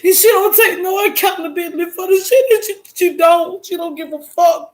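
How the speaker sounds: high, angry tearful rant; crying outburst. Real, not acted. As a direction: rant loudly through real sobs. Crying outburst about the other person.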